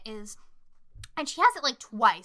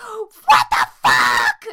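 gasp, then a long, loud mock scream; hammed-up panicked shout; imitated screaming; 10 semitones lower, 16 dB louder, much slower than usual. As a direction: bellow it slowly like someone losing it.

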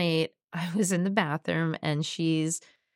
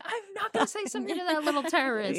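higher, fast, laughing through it; laughing retort; 10 semitones higher, faster than usual. Retold or imitated. Mom laughing as she retells refusing to apologize to her toddler. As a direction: toss it off, half laughing in mock outrage.

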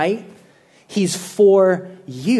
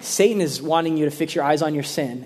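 ordinary talk, nothing marked

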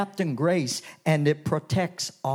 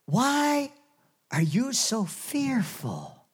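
gentle Jesus rebuke; 6 semitones higher, slower than usual. Acted. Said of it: "Jesus just looks at them and says".

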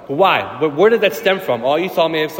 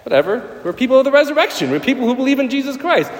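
much higher, fast and bright with conviction; exuberant retort; 8 semitones higher than usual. Retold or imitated. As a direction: brush off the worry with cheerful fire. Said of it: "I was like".

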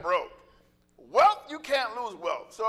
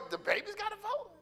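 high, softer put-on voice of disbelief; mock indignant question; 9 semitones higher, 8 dB quieter than usual. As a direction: play the shocked board member, pitched way up. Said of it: "I wanted to go over to Johnny and go".

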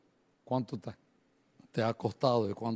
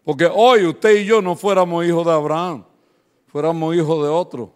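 loud, higher preaching voice; 4 semitones higher, 12 dB louder than usual. Acted. Pastor to the congregation retelling Isaac's question, preaching loudly. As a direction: proclaim the question to the crowd.

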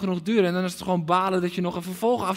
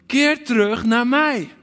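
louder, slow, raised call of Keer; heart cry; 4 semitones higher, 5 dB louder, slower than usual. Acted. Preacher voicing God, calling his people back loudly. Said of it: "die hartschreeuw".

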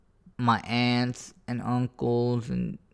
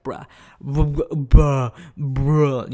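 louder, mushy calls as if through a mouthful of water; gargled voice; 2 semitones higher, 8 dB louder, much slower than usual. Pretend. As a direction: talk like your mouth is full of water. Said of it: "when you got water in your mouth".

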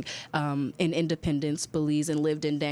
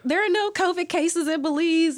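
mock protest; 11 semitones higher, 5 dB louder than usual. Group reassuring itself.

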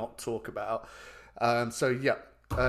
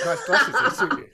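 gushing compliment; 9 dB louder than usual. Real, not acted. Complimenting glasses, laughing.

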